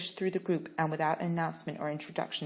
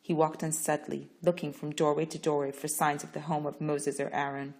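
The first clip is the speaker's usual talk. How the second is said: usual speaking voice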